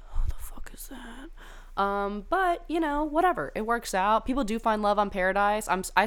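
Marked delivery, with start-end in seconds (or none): 0.1-1.8 slow whispered who is that; whispered baffled question. Retold or imitated. Imitating her own confused reaction to forgotten contestants, whispered. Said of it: "and I'll be like".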